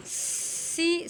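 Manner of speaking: سی with a sharp whistling hiss; whistling seen. Shown as a demonstration. Teacher isolating the whistle of سین, hissed. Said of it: "سین میں سیٹی کی آواز نکالیں گے".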